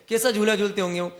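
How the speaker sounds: ordinary delivery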